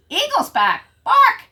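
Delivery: high, loud barking alarm. Acted. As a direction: bark a warning. Watchdog barking.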